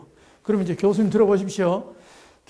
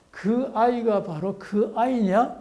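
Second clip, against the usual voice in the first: slow, higher 그 아이가 바로 그 아이냐 with a rising tune; Gyeongsang intonation; 4 semitones higher, slower than usual. Shown as a demonstration. Lecturer models a question melody for the class, slow. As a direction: carry the melody upward. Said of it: "요런 의미로".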